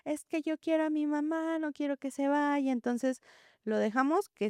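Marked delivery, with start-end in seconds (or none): 0.0-2.7 put-on high, childish pleading voice; upset child. Teacher imitating a crying preschooler at drop-off, high pleading child voice. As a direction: plead like a small boy who wants his mother. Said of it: "empiezan a gritar".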